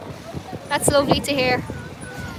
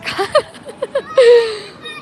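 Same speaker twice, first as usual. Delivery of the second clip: high loud cackle of laughter; loud laugh with a catch in it; burst of laughter; 7 semitones higher, 5 dB louder than usual. Real, not acted. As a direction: crack up at the joke. Laughing at joke.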